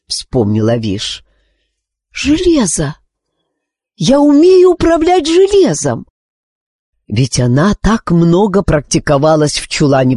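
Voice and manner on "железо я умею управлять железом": high, bright eureka cry; excited realization. A narrator's reading. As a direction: burst out with discovery. Sudden realisation.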